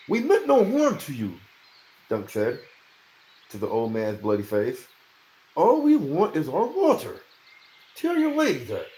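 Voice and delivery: earnest appeal. A narrator's reading. Dunk addressing old man.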